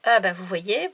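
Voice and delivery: ordinary talk, nothing marked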